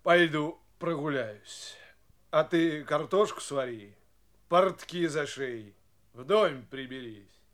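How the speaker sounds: usual speaking voice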